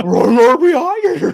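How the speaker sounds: high, slow cartoon dog voice; Scooby-Doo voice. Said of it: "You sound like Scooby there".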